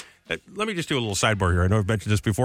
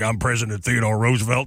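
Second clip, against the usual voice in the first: lightly put-on tough-guy president voice; Theodore Roosevelt. Host imagining the president's expected voice, mildly tough. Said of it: "he probably had a voice like".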